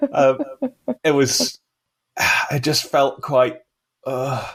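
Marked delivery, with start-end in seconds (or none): none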